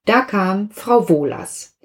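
ordinary delivery

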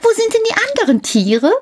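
Häwelmann shouting up, high and fast; high, quick calling child. A narrator's reading. Häwelmann asks the cat where the animals are, high and quick. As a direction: call up eagerly like a small boy. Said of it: "rief der kleine Häwelmann hinauf".

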